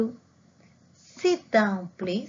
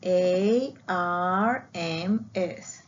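letters spelled out one by one, slowly; slow spelling; much slower than usual. Shown as a demonstration. Teacher spelling a word slowly for the class. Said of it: "acá formamos la palabra arms".

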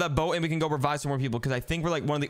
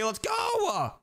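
high, excited call; rally your teammate to hurry; rallying cry. Real, not acted. Hurrying before the round.

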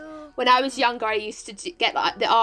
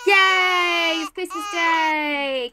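higher, drawn-out cheer; gleeful cheering; 5 semitones higher, 4 dB louder, much slower than usual. Real, not acted. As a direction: cheer it with long, stretched vowels. Celebrating Christmas Day in game.

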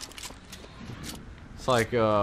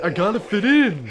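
mock rich guy; 8 semitones higher, 8 dB louder than usual. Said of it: "He's like".

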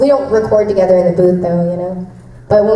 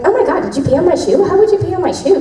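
walla background chatter; 8 semitones higher, faster than usual. Retold or imitated. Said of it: "I'm like".

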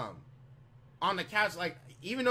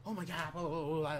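hushed mock panic, under the breath; retold freak-out; 2 semitones lower, 4 dB quieter than usual. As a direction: softly imitate her freaking out. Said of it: "sitting there freaking out".

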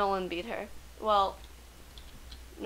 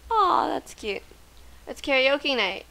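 ordinary delivery

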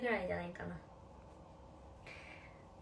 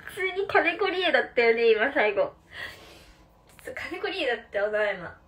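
laughing; 3 semitones higher, 11 dB louder than usual. Real, not acted. Laughing at own failed impression.

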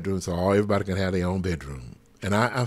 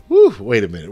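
loud startled woo then words; spirited woo; 10 dB louder, faster than usual. Real, not acted. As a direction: let the spirit hit you. Overcome in the spirit.

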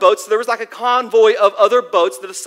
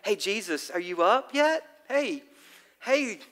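soft, tender mock wake-up call, much softer; gentle love pat; 9 dB quieter than usual. Disciples gently nudging sleeping Jesus, soft and sweet. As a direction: coax him awake like patting a sleeping child. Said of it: "like a love pat".

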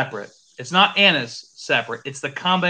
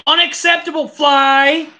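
loud, high mock outrage; scolding a fly; 10 semitones higher, 5 dB louder than usual. Real, not acted. Whiskey taster yells at a fly in his glass, loud mock outrage.

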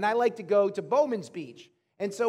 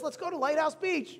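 put-on higher-pitched little boy voice; young son asking; 6 semitones higher than usual. Son to parent while driving over, lightly voiced high childish request. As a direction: lightly imitate a kid's request. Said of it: "and he says".

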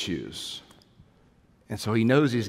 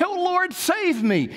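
pleading cry; 4 dB louder, slower than usual. Retold or imitated. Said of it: "and then you say".

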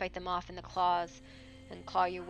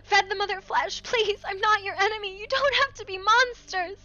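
screaming in fear and frustration; 13 semitones higher, 9 dB louder than usual. Acted. Rose screaming at the monsters to spare her, high and loud. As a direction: scream every line at them. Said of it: "Rose screams in fear and frustration".

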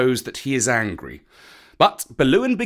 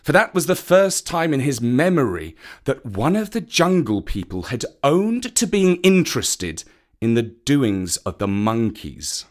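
usual speaking voice

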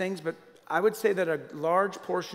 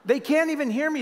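raised louder and higher; emphatic exclaimed repeat; 10 semitones higher, 6 dB louder than usual. Shown as a demonstration. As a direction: push it up the second time. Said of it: "I'll say things to other drivers".

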